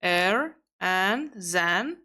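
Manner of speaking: slow emphatic repeat for learners; slow, stressed teaching repeat. Shown as a demonstration. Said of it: "So you can say".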